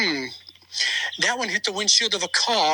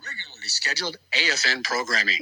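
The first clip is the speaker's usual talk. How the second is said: announcer sign-off; 4 semitones lower than usual. Pretend. Closing broadcast sign-off.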